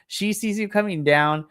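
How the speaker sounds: normal talking voice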